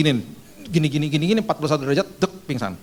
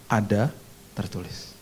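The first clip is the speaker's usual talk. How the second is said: quiet recital; 4 semitones lower, 5 dB quieter, slower than usual. Retold or imitated. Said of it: "Sebut seperti aku sebut".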